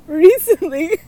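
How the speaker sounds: high and loud, fighting back giggles; bursting with laughter on the first word; laughing. Real, not acted. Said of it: "I can't, like, read this without laughing".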